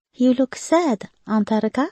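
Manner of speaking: put-on high, light little boy's voice; child voice. A narrator's reading. Little nephew to his sad aunt, high child voice. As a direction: voice a small boy gently. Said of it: "said Jacob".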